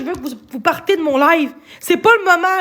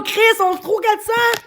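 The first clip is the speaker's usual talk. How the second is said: upset shout; 7 semitones higher than usual. Real, not acted. Upset appeal to friend on stream.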